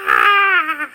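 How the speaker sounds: high, loud shriek of terror; the Master's panicked scream; terrified scream. Acted. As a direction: scream as if caught. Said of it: "The Master screamed".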